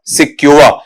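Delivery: "सिक्योर" as si-kyu-uh, r dropped; correct pronunciation. Shown as a demonstration. Teacher repeats the right reading for students, pronounced correctly. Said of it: "इसका उच्चारण जब आप लेते हैं".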